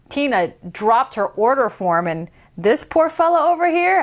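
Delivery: usual speaking voice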